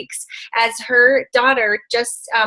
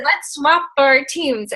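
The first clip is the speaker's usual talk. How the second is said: slow playful proposal; 3 semitones higher, much slower than usual. Retold or imitated. Both friends proposing together.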